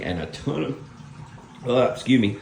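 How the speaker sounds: choking on a swallowed bug; just swallowed a bug. Real, not acted. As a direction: talk through a bug in the throat. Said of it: "think I swallowed a bug".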